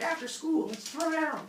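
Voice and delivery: high, taunting challenge; cocky hare. Retold or imitated. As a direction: puff up like a bully hare picking a fight.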